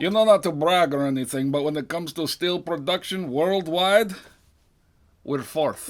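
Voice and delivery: boastful line in a cartoonish Russian accent; bad Russian accent. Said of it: "that terrible Russian accent".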